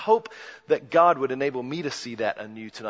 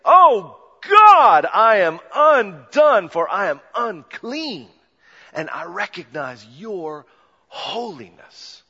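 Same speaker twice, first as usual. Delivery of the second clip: a high, loud, anguished cry to God; slow, overwhelmed outcry that fades toward the end; Isaiah's cry; 5 semitones higher, 12 dB louder, slower than usual. Retold or imitated. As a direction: cry out like a man undone before God. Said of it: "and you would say".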